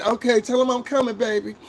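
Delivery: high, sing-song mom voice; mama's voice. Retold or imitated. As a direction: play the eager mom answering. Mama answers the child.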